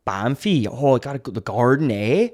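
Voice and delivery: lightly voiced Canadian accent; a hint of Toronto relatives chatting; mild imitation of a Canadian accent; Toronto family accent. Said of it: "I always kinda channel".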